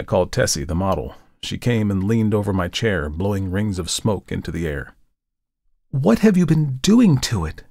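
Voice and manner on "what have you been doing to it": high, loud cry of dismay; exclaiming. A narrator's reading. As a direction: blurt it out, shocked. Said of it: "she exclaimed".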